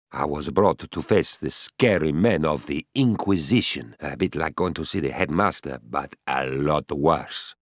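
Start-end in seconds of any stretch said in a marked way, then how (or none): none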